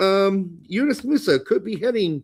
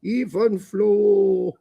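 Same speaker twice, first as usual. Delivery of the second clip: German accent; much slower than usual. Shown as a demonstration. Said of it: "you really gotta emphasize on that V".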